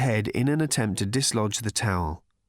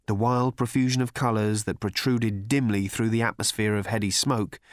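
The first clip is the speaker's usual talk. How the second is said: ordinary delivery, nothing marked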